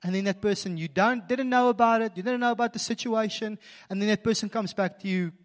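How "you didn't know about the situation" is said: normal talking voice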